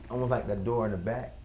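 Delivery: ordinary talk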